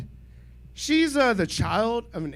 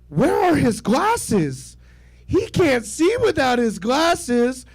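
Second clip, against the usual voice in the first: slow, high movie-line quote; quoting My Girl; 7 semitones higher, 5 dB louder, slower than usual. Retold or imitated. A light imitation of the wife quoting My Girl. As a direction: ham up the famous film line. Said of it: "the movie she decided to quote was My Girl".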